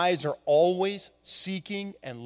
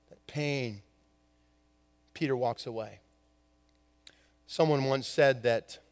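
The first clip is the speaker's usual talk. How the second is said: usual speaking voice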